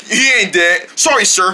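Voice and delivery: normal talking voice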